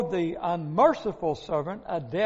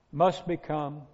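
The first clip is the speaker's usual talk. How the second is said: ordinary talk, nothing marked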